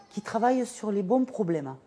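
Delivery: usual speaking voice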